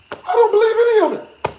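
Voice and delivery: high, loud exasperated cry; exasperated outburst. Real, not acted. To the chat, loudly exasperated. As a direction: throw up your hands in disbelief.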